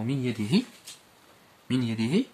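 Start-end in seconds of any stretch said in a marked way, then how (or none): none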